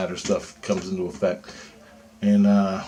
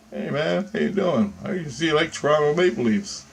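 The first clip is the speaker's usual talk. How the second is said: fake-friendly cop; 6 semitones higher than usual. Cop's friendly small talk.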